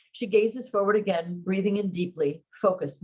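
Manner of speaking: plain reading voice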